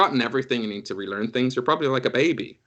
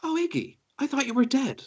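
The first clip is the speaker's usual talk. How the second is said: much higher, airy surprise; high dreamy Rua; 14 semitones higher than usual. Acted. Rua recognizes Iggy, high and airy. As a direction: float it out, mildly startled. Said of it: "she looks at you a little bit and goes".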